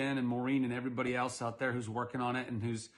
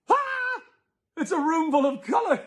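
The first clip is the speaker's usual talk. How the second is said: much higher, loud horrified cry; mock shock; 9 dB louder than usual. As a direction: shriek at the colorful room. Reader feigning shock.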